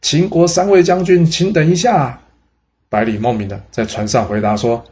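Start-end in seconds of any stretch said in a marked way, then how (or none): 0.0-2.2 calling out. A narrator's reading. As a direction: shout after a boat that is leaving. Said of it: "他大叫说". (高声大喊)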